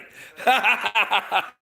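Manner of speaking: loud high burst of laughter. Real, not acted. Streamer bursts out laughing at Sweden. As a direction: crack up out loud. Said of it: "sorry for laughing".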